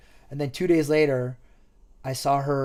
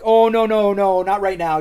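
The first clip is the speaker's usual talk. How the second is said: higher, louder flustered no; mock panicked protest; 5 semitones higher, 9 dB louder than usual. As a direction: push him away, flustered. Girl finds him in car.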